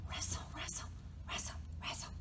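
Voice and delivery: hushed, whispery leaf sounds; soft unvoiced storybook sound effect; whispered rustle. A narrator's reading. Narrator imitates rustling leaves.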